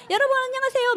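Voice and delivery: high bright hello to the room; mock excited high greeting; cheerful greeting. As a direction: chirp a delighted welcome.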